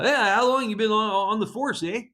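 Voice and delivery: hammed-up Canadian agent asking; Canadian accent. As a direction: play the chatty Canadian border agent.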